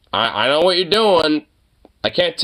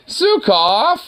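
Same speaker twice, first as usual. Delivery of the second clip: high, rising name call; calling the dog; 10 semitones higher than usual. Real, not acted. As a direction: call out to your dog, pitched up at the end.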